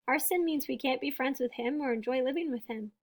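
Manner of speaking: usual speaking voice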